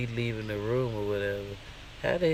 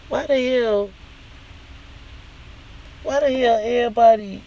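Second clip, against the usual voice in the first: higher and louder, demanding an answer; incredulous outburst; 12 semitones higher, 6 dB louder than usual. Real, not acted. Venting to viewers about fake friends, loud incredulous outburst. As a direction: throw the question out in disbelief.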